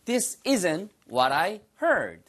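very slow, clear model sentence; slow teacher demo. Shown as a demonstration. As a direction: stretch every word for learners. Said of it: "발음이".

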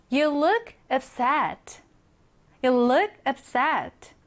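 slow, clear example sentence; slow model reading. Shown as a demonstration. Teacher reading the example slowly to learners. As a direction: read it twice, slowly and clearly. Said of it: "너는 어때 보이는 거예요?".

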